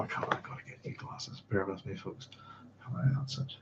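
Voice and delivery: whispered mutter to self, off mic; whispered aside. Real, not acted. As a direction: murmur it to yourself while squinting. Muttering while reading the screen.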